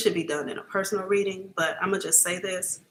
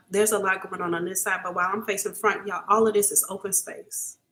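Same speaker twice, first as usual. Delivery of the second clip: ordinary talk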